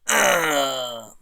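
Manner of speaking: loud hammed-up groan of defeat; mock pained groan. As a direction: groan like you just got knocked flat. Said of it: "and I go".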